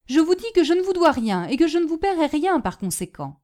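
high, loud, hurried refusal; indignant schoolboy. Acted. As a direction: flatly refuse to pay.

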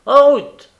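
very loud, high cry; driver's ringing command; shouted departure call. A narrator's reading. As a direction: call the coach away. Courier orders coach to leave.

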